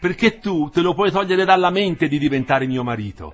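loud, high scornful retort; proud woman rejecting her fiancé; defiant rejection. Acted. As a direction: throw him off proudly. She rejects him defiantly.

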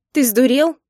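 high, loud indignant question; indignant outburst. A narrator's reading. Wife struggling in husband's grip, indignant outburst. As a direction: throw it back at him in outrage.

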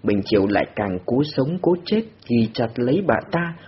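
plain reading voice

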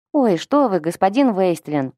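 usual speaking voice

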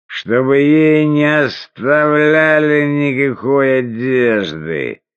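пьяный голос, тянет слова медленно; выше и медленно, как пьяный; town drunk. Acted. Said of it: "Ты пьян!". (пьяный хозяин требует раздеть пленницу, пьяная речь)

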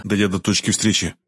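ordinary delivery

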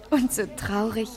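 ordinary talk, nothing marked

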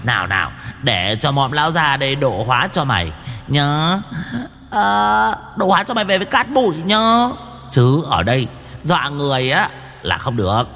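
high squeaky sermonizing imp; preachy imp voice. Acted. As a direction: lecture like a smug little demon. Preachy demon voice.

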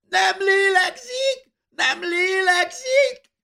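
very high, drawn-out panicked shriek; screaming. A narrator's reading. Boy cries out that his sister is lifeless, screaming. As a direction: shriek in terror, stretching each word. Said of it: "sikító hangon felkiáltott".

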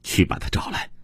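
fierce, voiceless rasp of a command; delirious woman's vicious whispered demand. A narrator's reading. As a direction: spit out the order in a harsh whisper. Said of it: "阿娜恶狠狠地回答说".

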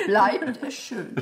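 BLEIBT ES SCHÖN said lightly through a laugh; BLEIBT echoed with laugh. Shown as a demonstration. Actress tries her partner's line, amused echo. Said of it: "du machst bleibt".

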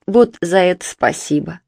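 plain reading voice

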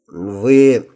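husky, voice catching; suddenly hoarse. Acted. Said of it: "внезапно охрипшим голосом сказал я".

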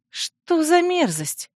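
high, drawn-out disgust. Acted. Princess offered a staff with a skull on it, disgusted. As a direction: recoil from it, slow and high-pitched.

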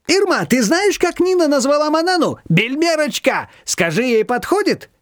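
high, eager phone gossip; excited gossip. A narrator's reading. Shushana telling Irma on the phone, excited. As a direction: share the news breathlessly.

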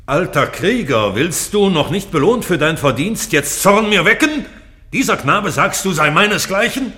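lauter, hoher Zornesausbruch; zorniger König. Acted. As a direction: donnert den Alten voller Wut an. König fährt den alten Krieger an. (der König schreit den alten Krieger zornig an)

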